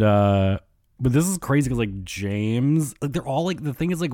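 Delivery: ordinary delivery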